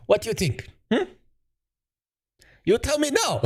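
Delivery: high, thin put-on Chinese accent in broken English; mock Chinese accent.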